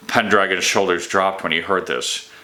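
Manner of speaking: ordinary delivery, nothing marked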